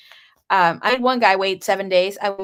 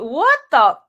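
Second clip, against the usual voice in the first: shocked; 6 semitones higher, 8 dB louder than usual. Retold or imitated. Shock at the pregnancy news.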